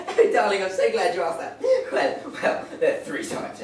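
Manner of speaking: hammed-up posh mother, laughing; mum laughing through it. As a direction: gush at her while giggling. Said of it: "Mum just went".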